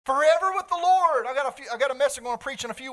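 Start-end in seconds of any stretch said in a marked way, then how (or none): 0.0-1.2 higher and louder, proclaimed; ringing proclamation. Real, not acted. Pastor proclaims eternity to his congregation, louder and higher. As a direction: lift it up and declare it to the church. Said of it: "Think about this".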